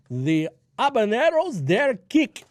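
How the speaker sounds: botched attempt at an Indian accent; bad Indian accent. Host joking about chili peppers, in a bad Indian accent. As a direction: put on a hammy Indian accent. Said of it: "you don't do an Indian accent".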